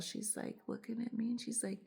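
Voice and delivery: usual speaking voice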